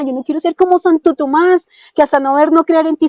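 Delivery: pleading prayer. Retold or imitated. On her knees she begs the Lord, high and fervent. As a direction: beg the Lord with rising urgency.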